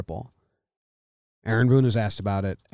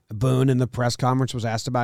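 usual speaking voice

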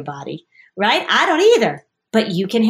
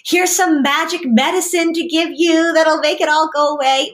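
high, sing-song reassuring mother voice; a hammed-up, over-soothing mother reassuring her child; hammed-up soothing mom; 8 semitones higher, 3 dB louder, slower than usual. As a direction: coo at the child like an overprotective mom. Therapist acting reassuring mom.

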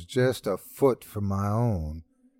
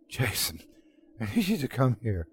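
mild sobbing; 5 dB quieter than usual. Acted. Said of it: "I said, sobbing".

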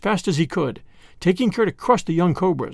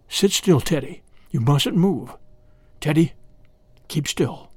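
ordinary delivery, nothing marked

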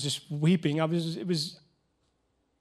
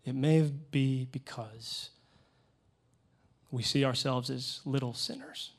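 ordinary talk, nothing marked

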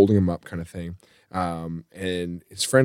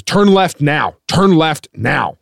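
higher, forceful imitation of a demanding passenger; yelled order; 5 semitones higher, 4 dB louder, slower than usual. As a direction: demand it loudly, dragging the last word. Acted drunk passenger yelling orders.

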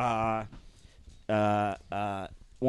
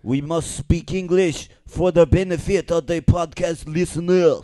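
higher, hammed-up French accent; thick mock French accent; 4 semitones higher, 4 dB louder than usual. Shown as a demonstration. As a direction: protest like a pompous Frenchman. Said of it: "Do French".

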